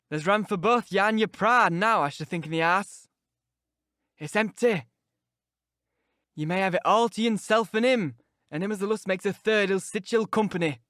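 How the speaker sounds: put-on a sour old servant jeering at his pride; taunting Yorkshire servant. Old servant Joseph taunting the proud guest, sneering Yorkshire voice.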